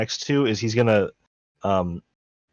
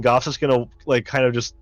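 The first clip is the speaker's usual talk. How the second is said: ordinary talk, nothing marked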